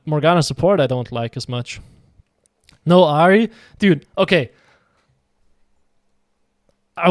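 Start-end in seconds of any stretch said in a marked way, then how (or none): none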